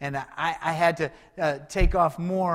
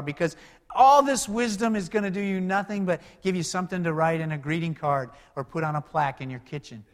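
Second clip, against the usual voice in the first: usual speaking voice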